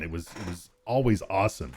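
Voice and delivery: ordinary delivery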